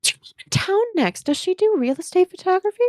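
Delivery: high, eager questions; wide-eyed, high and eager; eager excitement. Acted. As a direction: pounce on a business lead. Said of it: "Honey's eyes get wide".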